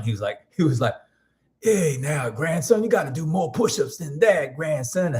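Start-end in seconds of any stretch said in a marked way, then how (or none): 1.6-5.1 higher-pitched impression of an elder's Louisiana twang; imitated grandpa twang, pitched up; grandfather's old-time accent. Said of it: "That's how he used to t-, he had a real interesting accent".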